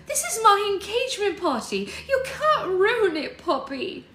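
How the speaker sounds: very high, loud hysterical protest; distraught wailing. A narrator's reading. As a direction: wail it at her, high and loud. Sarah wailing about her party.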